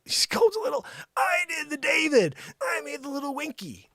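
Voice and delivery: high-pitched incredulous protest; hammed-up outrage; mock indignation. Indignant echo of the slight.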